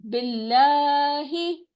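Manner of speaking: बिल्लाहि with stretched लाहि; slow drawn-out बिल्लाहि; slow recited billahi. Shown as a demonstration. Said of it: "फिर क्या है".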